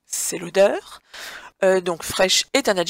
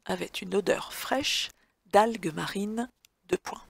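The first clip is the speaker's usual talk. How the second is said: soft, even dictation reading; dictating; 5 dB quieter than usual. Shown as a demonstration. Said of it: "dictée read to the class".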